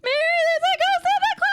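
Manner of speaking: put-on very high, loud child's shriek; screaming kid. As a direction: scream for help like a small child. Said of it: "The kid just starts screaming".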